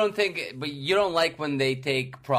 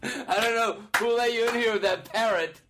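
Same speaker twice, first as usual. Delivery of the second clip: amused officiant; 5 semitones higher than usual. Pretend. Officiant addresses pirate, laughing.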